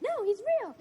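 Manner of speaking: high, girlish mock Caroline. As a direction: pitch it way up as a smitten girl. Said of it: "I liked her voices".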